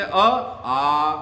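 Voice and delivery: अ said short, then आ drawn out to double length, slowly; short then long vowel. Shown as a demonstration. Teacher showing students short अ against long आ. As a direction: say अ once, then stretch आ long. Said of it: "दीर्घ का मतलब है दो गुनी आवाज".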